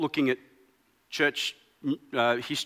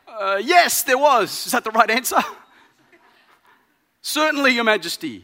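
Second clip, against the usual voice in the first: a hint of nervous courtiers, higher and louder; flustered advisor; 6 semitones higher, 8 dB louder than usual. Advisors answering the startled king, lightly voiced and flustered. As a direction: play hasty servants scrambling to agree.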